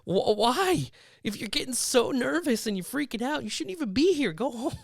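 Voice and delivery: hammed-up incredulous telling-off; mock scolding. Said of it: "I'm like".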